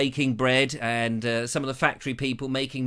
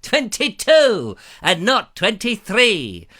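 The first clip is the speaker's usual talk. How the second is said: a lightly voiced magic mirror, higher and drawn out; 4 semitones higher, 4 dB louder, slower than usual. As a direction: intone it like a fairy-tale mirror. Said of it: "look in the magic mirror and say".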